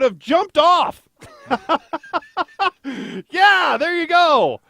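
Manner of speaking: high, slow laughing delivery; amused laughing reaction. Real, not acted. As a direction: crack up over the punchline. Laughing at ex-wife's quip.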